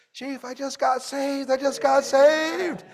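put-on high, slow, wondering kid's voice; a boy announcing he got saved to the leader; overjoyed little boy. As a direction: let a small boy savour his big news. Boy returns newly saved.

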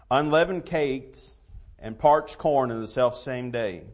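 scripture reading. Real, not acted. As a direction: read the King James verses with weight. Reads King James scripture aloud.

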